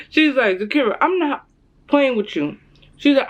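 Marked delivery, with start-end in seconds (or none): 0.6-2.6 raised-pitch imitation of a strict mom; mock threat. Strict mom warns her daughter, retold mock threat. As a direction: warn her, half in jest. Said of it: "she kinda threatened me a little".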